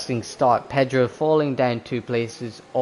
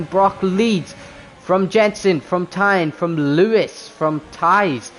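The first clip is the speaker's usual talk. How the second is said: excited, high play-by-play; motorsport commentator; 6 semitones higher, 4 dB louder than usual. Acted. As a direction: call the race start live. Live race start play-by-play.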